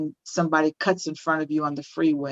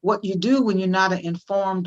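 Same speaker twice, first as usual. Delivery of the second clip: ordinary talk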